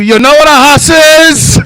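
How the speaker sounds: very high loud gangster voice; Scarface taunt. Pretend. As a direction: taunt them like a movie gangster.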